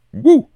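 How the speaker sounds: short, high, loud "woo!"; excited whoop. Real, not acted. Radio host cheering Leverkusen's unbeaten run, excited whoop. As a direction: let out a sudden loud cheer.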